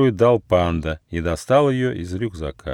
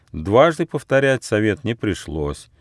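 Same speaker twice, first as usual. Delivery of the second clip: ordinary delivery, nothing marked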